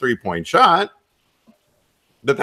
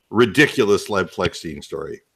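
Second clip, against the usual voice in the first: usual speaking voice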